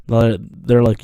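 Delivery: ordinary delivery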